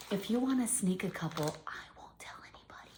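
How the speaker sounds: conspiratorial whisper. Real, not acted. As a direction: whisper it like a secret. Sneaky aside to kids.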